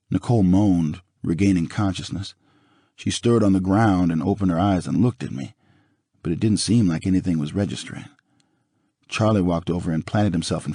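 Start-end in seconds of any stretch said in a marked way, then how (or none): none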